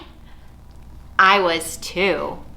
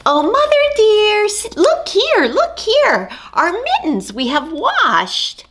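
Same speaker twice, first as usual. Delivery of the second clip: high, louder kitten voices calling out; proud kittens; 12 semitones higher, 5 dB louder than usual. Acted. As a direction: show off like eager kittens. Kittens proudly show clean mittens.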